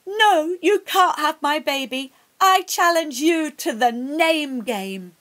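defiant shout. Acted. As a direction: stand up to the witch with a loud challenge. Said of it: "Nan shouted at the witch".

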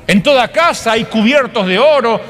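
ordinary delivery

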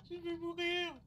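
high frightened cry; panicking gamer. Real, not acted. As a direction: wail that death is coming. About to die in game.

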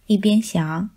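usual speaking voice

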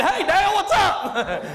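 a breezy mock shout-out; mock greeting. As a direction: call out to him like an old buddy. Calling to Dale Jarrett.

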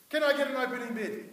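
brisk loud auctioneer opening; auctioneer call. Acted. Opening a mock baby auction.

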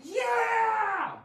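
loud high cheering yeah; excited shout. Shown as a demonstration. Teacher acting out excitement for the class. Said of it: "my emotion was excitement".

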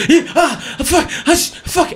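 high, frantic outburst of broken yelps; going crazy. Retold or imitated. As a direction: lose your mind from the side of the stage. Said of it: "but then I go crazy".

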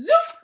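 high, loud upward zip; quick rising wand zap; magic wand zoop. Acted. Father making the saw vanish, rising zoop.

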